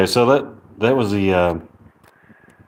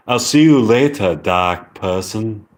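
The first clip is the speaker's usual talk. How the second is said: British accent, slowing at the end; amateur British accent with dropped Rs. Shown as a demonstration. Practising a goodbye from the lesson, British accent. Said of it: "that was a pretty good, uh, British accent lesson".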